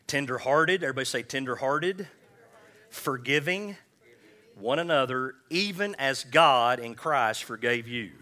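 rousing sermon delivery; full-force preaching. Acted. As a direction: preach it out, stirring the room.

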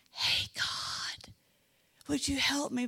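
hushed, breathy whisper to God. Pretend. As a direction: whisper the plea so no one hears. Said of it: "David should have been saying".

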